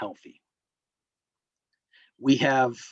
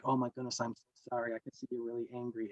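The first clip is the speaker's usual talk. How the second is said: much softer, calming apology, quicker at the end; calm soothing apology; 2 semitones lower, 14 dB quieter, faster than usual. Retold or imitated. Driver calming an exploding driver, soft and soothing. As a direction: hush and soothe an angry person. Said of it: "I can come exploding all over them and they can be like".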